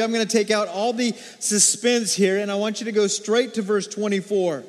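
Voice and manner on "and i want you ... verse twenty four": usual speaking voice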